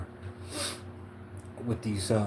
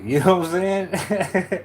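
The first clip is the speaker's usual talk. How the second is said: higher, louder, words through laughter; real laughing; 7 semitones higher, 4 dB louder, slower than usual. Real, not acted. Reader amused by letting the shadow out, laughing. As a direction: crack up while saying it. Cracks up at own remark.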